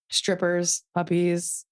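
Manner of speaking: usual speaking voice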